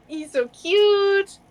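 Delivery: slow, high-pitched gushing; real cooing. Real, not acted. Speaker holding their cat, cooing adoringly. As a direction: coo it drawn out over a pet.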